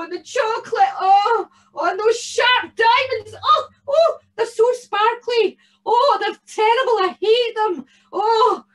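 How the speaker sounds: put-on very high, loud wailing old woman; an old lady pretending to be horrified; mock-distressed old lady. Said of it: "the old lady said".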